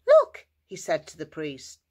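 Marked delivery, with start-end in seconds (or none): 0.1-0.5 very high, loud burst; excited call. Acted. Healed man to the priest, excited call. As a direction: grab their attention with delight.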